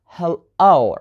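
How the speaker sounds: hello split, with a strong long O; stress on O. Shown as a demonstration. Teacher repeating the example for learners, stress on the O in hello. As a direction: hit the O at the end of hello. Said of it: "put the stress on the letter O".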